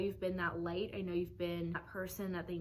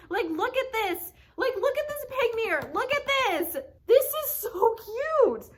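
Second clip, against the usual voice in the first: much higher and louder, squealing with delight; excited squeal; 13 semitones higher, 8 dB louder than usual. Real, not acted. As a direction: gush over something adorable. Gushing over a pig mirror.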